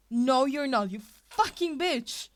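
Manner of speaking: loud, venomous shout; furious yell. A narrator's reading. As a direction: spit it out in a rage. Izuku raging at Ashido.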